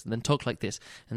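soft narrator demo. Shown as a demonstration. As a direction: drop into a muted reading tone. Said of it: "people talk like this".

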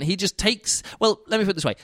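ordinary talk, nothing marked

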